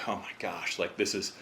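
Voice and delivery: frustrated under breath. Retold or imitated. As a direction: grumble it quietly to yourself. Frustrated while not catching fish.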